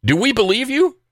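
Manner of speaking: high, sarcastic "do we believe you"; mock disbelief. Host scoffing at the governor, incredulous. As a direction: scoff at the claim.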